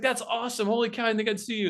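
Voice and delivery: high, fast delighted chatter; mock-excited reunion babble; imitated excited friend. Retold reply of delighted friend.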